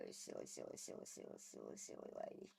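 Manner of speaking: soft whisper, sing-song repeats; whispered cooing. Real, not acted. Whispering fondly to the pet, soft coo. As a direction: breathe it out to the pet.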